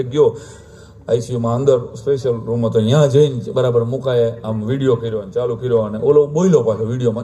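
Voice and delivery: ordinary talk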